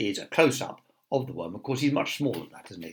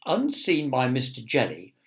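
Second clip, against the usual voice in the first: ordinary delivery, nothing marked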